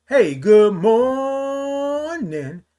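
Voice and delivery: high, very slow, stretched-out greeting; drawn-out greeting. Real, not acted. Host welcoming viewers to the live stream, drawn out. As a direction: hold the 'morning' long, up high.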